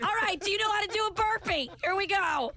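high pumped coach call; rally them like a trainer; drill sergeant. Pretend. Workout instructor bit.

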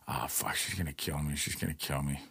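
low, very soft worry to oneself; retold doom, barely voiced; hushed dread. Retold dread while waiting for girlfriend.

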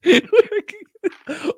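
squealing laugh, high and loud; high burst of laughter. Real, not acted. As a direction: let out a high-pitched cackle. Burst of laughter at show history.

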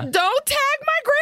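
high-pitched mock plea; mock outcry. Host begging the app not to tag grandma, high mock outcry. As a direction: squeal it in comic protest.

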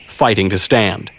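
ordinary delivery, nothing marked

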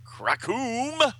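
high, stretched comic-book crash; long booming sound effect; explosion noise. Retold or imitated. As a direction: belt out a cartoon kaboom.

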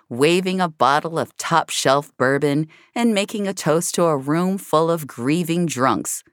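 plain reading voice

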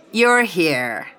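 a touch of glad excitement; cried out. A narrator's reading. Gwen greeting Becca at the pub, lightly excited. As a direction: greet her happily, lightly voiced. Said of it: "Gwen cried".

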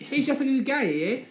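high, loud hammed-up Canadian accent. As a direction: overdo the Canadian eh. Mock Canadian, much higher and louder.

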